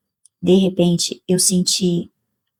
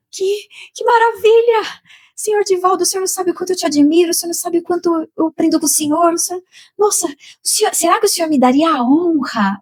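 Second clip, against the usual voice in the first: much higher, rushed admiring praise; nervous fan gushing; 9 semitones higher than usual. Retold or imitated. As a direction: gush at your hero, flustered. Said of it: "tão emocionada, tão nervosa".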